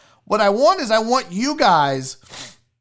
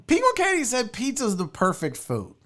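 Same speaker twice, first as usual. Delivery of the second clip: usual speaking voice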